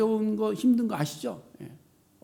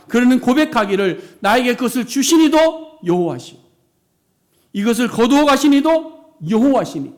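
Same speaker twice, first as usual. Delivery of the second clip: loud, raised sermon proclamation; full-force preaching; 4 semitones higher, 8 dB louder than usual. Acted.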